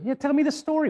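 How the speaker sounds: high, loud, pushing for the story; insistent mechanic. Retold or imitated. Mechanic pressing the caller for the story, insistent and high.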